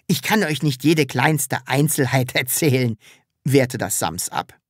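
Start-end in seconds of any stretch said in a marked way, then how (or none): none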